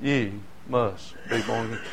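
a soft, solemn echo of the refrain; hushed refrain. Acted. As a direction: let it fall quiet after the story, grave and sorrowful. The refrain after a long pause.

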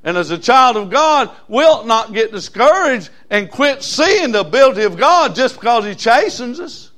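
loud, high, fast building sermon cry; full-force preaching. Acted. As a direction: lift it to heaven, fervent. Preaching climax, congregation.

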